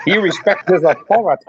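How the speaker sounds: put-on Canadian accent. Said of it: "In a Canadian accent".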